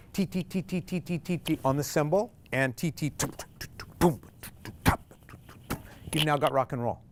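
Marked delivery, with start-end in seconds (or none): 0.1-5.8 soft spoken "T, t" ticks with a "boom" for the drum kit; drum beat mouth sounds. Shown as a demonstration. Teacher imitating a drum kit for ukulele students, soft ticks. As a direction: tap out a rock beat with quiet ticks and a "boom". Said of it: "So you've just created".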